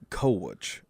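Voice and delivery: "coach" drawn into two syllables, koh-uch; Southern coach. Shown as a demonstration. Grandson demonstrates grandfather's two-syllable "coach".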